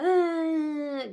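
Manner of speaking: a high, loud, put-on toddler whine; imitated toddler whinge. Mum imitating her toddler's whinge over the doll, high and loud. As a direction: mimic a small child's whiny cry, cut short. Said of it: "And she had a little whinge".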